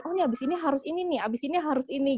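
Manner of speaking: quick, high-pitched imitated urgency; pressured reminder. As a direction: act out fretting over the next task. Acting out to-do pressure.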